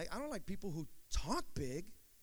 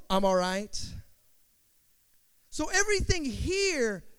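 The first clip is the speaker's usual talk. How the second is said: loud, raised-pitch sermon delivery; full-force preaching; 5 semitones higher, 10 dB louder than usual. Real, not acted. Preacher urging the congregation to fight for truth, full-force preaching. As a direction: preach it out with stretched key words.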